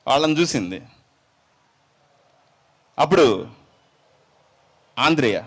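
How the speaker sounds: ordinary talk, nothing marked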